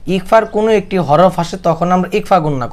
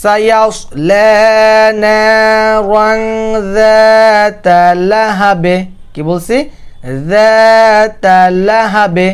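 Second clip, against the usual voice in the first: slow chanted যাতা লাহাবে, drawn out; recitation demo; 5 semitones higher, 4 dB louder, much slower than usual. Shown as a demonstration. Teacher showing the stop on লাহাবে. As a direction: intone it slowly, stopping on লাহাবে. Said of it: "দেখেন".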